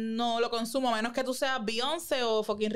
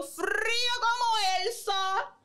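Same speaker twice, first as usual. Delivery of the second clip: high, drawn-out exclamation; exagerated amazement; 11 semitones higher, much slower than usual. Real, not acted. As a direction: stretch it out high for dramatic effect. Hyping the audience's shock. (asombro exagerado, agudo y alargado)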